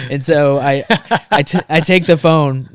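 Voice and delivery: high, loud, through laughter; laughing. Real, not acted. Storyteller resuming after his own joke, laughing loudly. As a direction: carry on telling it while cracking up.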